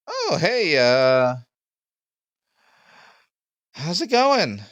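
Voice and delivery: very high, loud greeting; big high-pitched welcome; flamboyant host. Acted. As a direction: sing out hello in a high put-on voice. Game master voices person descending stairs.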